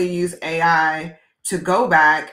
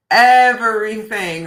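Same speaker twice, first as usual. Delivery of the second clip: everything stretched slow and loud; "everything" said slowly and louder, for emphasis; drawn-out emphasis; 8 dB louder, slower than usual. Real, not acted. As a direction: lean hard on "everything," slowly. Emphasis on how much AI tells.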